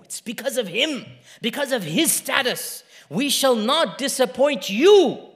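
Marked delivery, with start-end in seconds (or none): 3.1-5.3 slow, loud, high pledge; emphatic, ringing assurance; proclaimed promise. Retold or imitated. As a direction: declare it with full conviction. Same promise, gentle then proclaimed.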